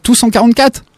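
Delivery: very high incredulous question; can't believe it; surprised. Real, not acted. Étonnement devant la collection. (incrédule)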